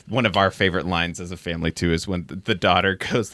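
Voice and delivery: ordinary talk, nothing marked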